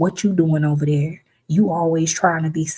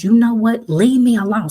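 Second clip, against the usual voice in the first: much higher, louder, snapping him away; loud telling-off; 6 semitones higher, 6 dB louder than usual. Retold or imitated. Woman fed up with a pushy man, loud telling-off. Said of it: "Start talking loud".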